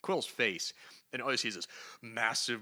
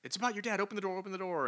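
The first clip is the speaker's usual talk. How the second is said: high, pleading calls at the door; urgent Guardians; 10 semitones higher, much faster than usual. Retold or imitated. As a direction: coax him to open up. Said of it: "they're like".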